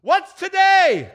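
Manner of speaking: high, loud, drawn-out holler; calling down. Acted. Scrooge calling down to a boy, high loud holler. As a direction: call out to someone below. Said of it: "calling downward to a boy".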